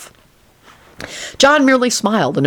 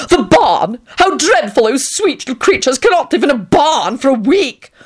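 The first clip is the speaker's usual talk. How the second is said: Tony, affected and gushing; 8 semitones higher than usual. A narrator's reading. Tony aghast.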